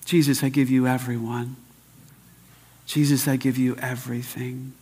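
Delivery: low, subdued prayer; quiet prayer. Shown as a demonstration. Preacher handing his children over to God, quiet prayer. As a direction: pray it softly and low, pausing between the lines. Prays after story.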